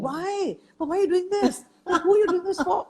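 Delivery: high, harried nagging, a little laughing; anxiety voice. Retold or imitated. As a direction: play the frazzled menopausal mum snapping at the family. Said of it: "what comes out is an anxiety voice".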